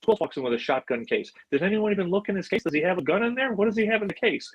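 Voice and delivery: high, rapid-fire movie-star riff; Vince Vaughn. Retold or imitated. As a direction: pile up mock-suspicious questions, voice climbing. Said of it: "just like he's in the movie".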